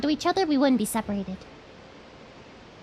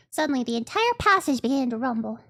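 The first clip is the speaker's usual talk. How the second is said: ordinary talk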